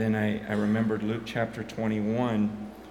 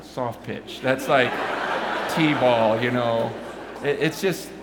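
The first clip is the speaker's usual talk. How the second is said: laughing while talking; amused laughter; 4 dB louder than usual. Real, not acted. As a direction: chuckle through the words.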